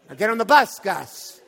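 loud, hammed-up dismissal; mock order. Preacher jokingly sends stranger to the bus, loud mock order. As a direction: shoo him off with a big voice. Ends mock Dickens refusal.

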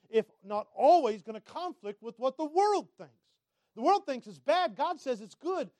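high, loud sermon building to a peak; full-force preaching. Acted. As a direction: drive the contrast home, punching key words.

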